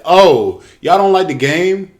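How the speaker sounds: high, loud, smug mock spokesman; mocking company voice. Gamer mocking EA's free-game offer, high smug voice. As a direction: sneer it out like a fake generous company. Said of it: "Madden and E A came out and said".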